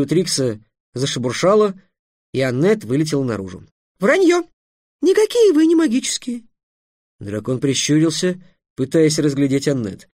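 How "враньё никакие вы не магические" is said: very high squeaky indignant voice; outraged fairy. Acted. Fairy contradicting the dragon's claim to magic, outraged. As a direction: pipe up furiously, far above your own pitch.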